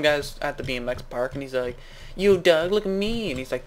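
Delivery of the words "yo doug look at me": mock show-off. Voices show-off in improvised story.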